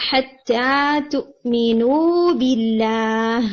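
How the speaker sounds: حَتَّى تُؤْمِنُوا بِاللَّهِ recited slowly with the long vowels held; recitation demo. Shown as a demonstration. Teacher showing where the long vowel was missed, slow recitation. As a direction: draw out each long vowel. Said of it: "یہاں پر بھی".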